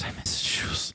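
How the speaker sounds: imitating a sad little boy, whispered; mumbled child whisper. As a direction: hush it like a sleepy kid mumbling. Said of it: "And he mumbles".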